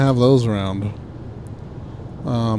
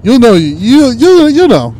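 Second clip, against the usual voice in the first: loud insisting; 8 semitones higher, 7 dB louder, slower than usual. Real, not acted. Insisting in banter.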